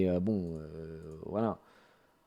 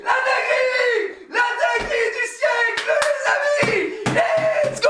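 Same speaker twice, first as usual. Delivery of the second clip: ecstatic screaming; slower than usual. Real, not acted. Huge gacha pull, screaming.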